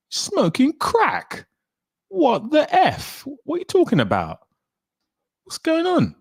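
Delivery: high-pitched, squeaky disbelief; incredulous outrage. Real, not acted. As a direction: drag it out high, utterly incredulous. Mock outrage at chat's opinion.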